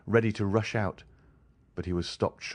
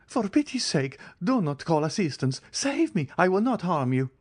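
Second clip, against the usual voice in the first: very high, desperate begging; terrified repeated plea, climbing higher; frantic pleading; 10 semitones higher than usual. A narrator's reading. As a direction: plead for your life, voice cracking high. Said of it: "he cried, pale and bewildered".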